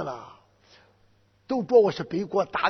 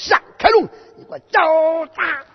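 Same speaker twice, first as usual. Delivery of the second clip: triumphant battle cry; 8 semitones higher, 5 dB louder than usual. Acted. Boy striking the final blow.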